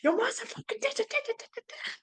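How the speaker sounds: very high, squeaky mock rant; high-pitched swearing trailing into babble; retold outburst. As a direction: re-enact a startled tirade in a tiny high voice. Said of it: "I'm going".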